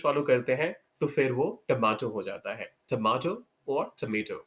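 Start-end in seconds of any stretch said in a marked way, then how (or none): 2.9-4.4 tomato as tuh-MAY-toh, then tomato as tuh-MAH-toh; American and British tomato. Shown as a demonstration. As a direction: contrast the two tomato vowels. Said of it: "American English ... British English".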